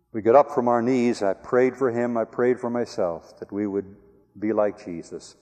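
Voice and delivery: usual speaking voice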